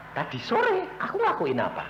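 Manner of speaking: high, startled echo with a laugh; surprised. Acted. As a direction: caught off guard and laughing it off. Surprised reply to God's question. (kaget sambil tertawa, nada tinggi)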